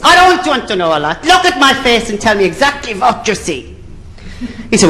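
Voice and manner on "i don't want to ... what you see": high, brusque interviewer voice; Nicky Lauder. Acted. Airline boss interrupting a pilot applicant, brusque. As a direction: cut in with a high, comic boss voice. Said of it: "And Nicky Lauder said".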